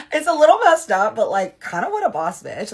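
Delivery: loud, high-pitched laughing delivery. Real, not acted. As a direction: burst out laughing into the words. Laughs at the story's punchline.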